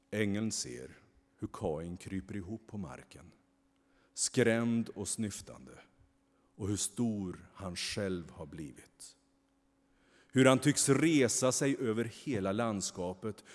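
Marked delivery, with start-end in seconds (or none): none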